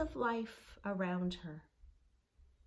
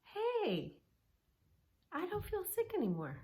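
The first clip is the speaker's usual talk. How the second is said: put-on pleasantly surprised girl; 11 semitones higher than usual. Girl noticing she feels better.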